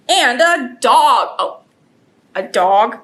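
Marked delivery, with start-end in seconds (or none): none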